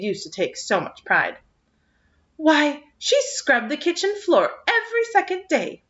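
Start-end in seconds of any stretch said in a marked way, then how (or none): 2.4-5.8 high, indignant neighbour's complaint; scandalised at the state of the house; indignant gossip. A narrator's reading. Missus Lynde to Marilla, indignant and high. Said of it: "Missus Lynde told Marilla Cuthbert indignantly".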